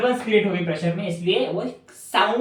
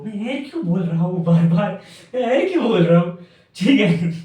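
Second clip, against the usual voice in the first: real amused laughter through the words, louder; laughing at himself; 5 dB louder than usual. Real, not acted. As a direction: laugh at your own silly slip. Laughs at own repeated slip. (अपनी गलती पर हँसते हुए)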